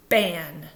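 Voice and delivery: Fan said with lips pressed together, no blown air; wrong F. Shown as a demonstration. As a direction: demo the mistaken Fan with closed lips. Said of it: "sometimes you're gonna do this".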